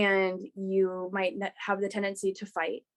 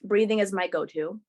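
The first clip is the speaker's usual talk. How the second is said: ordinary talk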